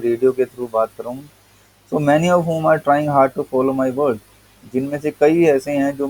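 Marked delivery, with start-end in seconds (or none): 1.9-4.2 reading the essay slowly, word by word; slow text reading. Acted. Teacher reading the English essay to students, slow and deliberate. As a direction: read each word out to the class.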